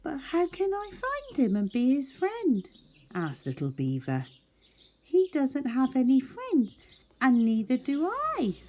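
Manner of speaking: small high eager animal voice; Little Beaver voice. Acted. Little Beaver asking new animals to be friends, high eager voice. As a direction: play a hopeful little beaver, bright and high.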